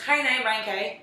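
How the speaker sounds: "Hi, now, brown cow" in an Irish accent, with the "ow" turned toward "eye"; light Irish accent that makes "cow" sound near "kye". Shown as a demonstration. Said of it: "So I'll teach you how to do an Irish accent".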